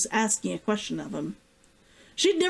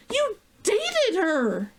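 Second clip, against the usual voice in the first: slow, higher accusing retort; rising voice; 12 semitones higher, much slower than usual. A narrator's reading. Himiko throws it back at Deckard, voice rising. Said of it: "her voice rising".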